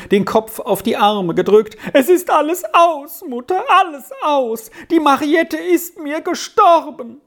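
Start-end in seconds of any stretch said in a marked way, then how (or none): 1.9-7.2 high-pitched, anguished outcry; grief-stricken son crying out high; sobbing. A narrator's reading. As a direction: wail it in grief, pitched far up. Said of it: "warf sich selbst über den Tisch hin und schluchzte".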